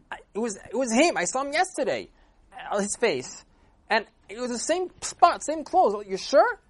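high, loud disbelieving protest; shocked protest. Acted. Shocked husband's friend protesting to the Rav, high and loud.

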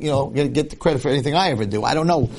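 ordinary talk

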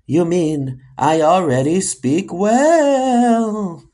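slow, drawn-out spooky correction; eerie, slowly rising voice. Acted. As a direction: stretch the last word like a phantom. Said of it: "not in that spooky voice".

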